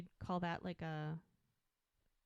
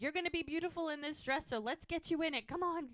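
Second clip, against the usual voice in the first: high-pitched mild mock shout; high, lightly raised calling voice; retold shouting; 8 semitones higher than usual. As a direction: imitate shouted encouragement, pitched up. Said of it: "They literally have to shout".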